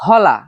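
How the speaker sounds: হলা said as the local word for broom; dialect word. Shown as a demonstration. Villager naming the local word for broom. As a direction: present হলা clearly. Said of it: "আঞ্চলিক ভাষায় এটাকে বলে".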